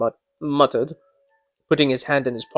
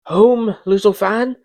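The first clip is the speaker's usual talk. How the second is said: put-on surprised boy; 6 semitones higher than usual. A narrator's reading. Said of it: "returned the boy".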